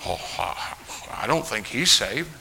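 hushed, hesitant imitation of a doubter; mock doubtful critic. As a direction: voice a doubtful critic under your breath. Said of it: "too many soul winning courses would say".

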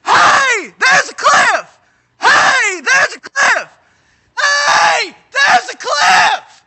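high, full-force shouted warning; yelling a warning. Acted. Preacher yelling to warn a biker about the cliff, frantic high shout. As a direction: yell it loud and high, stretching the last word. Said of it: "you start yelling".

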